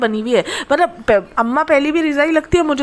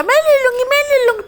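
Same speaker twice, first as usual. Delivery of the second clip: high, eager begging voice; mock greedy girl; 11 semitones higher than usual. Vlogger mimics the girl who wants the dryer, high mock begging. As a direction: squeak it out like a greedy child.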